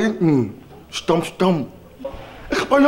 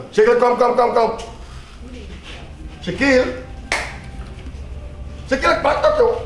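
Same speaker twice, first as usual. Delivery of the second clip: calling out; 7 semitones higher than usual. Acted. Leraar roept leerling tot de orde.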